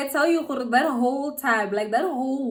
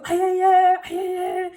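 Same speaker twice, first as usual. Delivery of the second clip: put-on high, loud whooping of a boy pack; noisy cheering; 10 semitones higher, 9 dB louder than usual. Imitating his friends bringing him the news, noisy cheering. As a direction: imitate boys erupting in celebration. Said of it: "you guys are here making a noise".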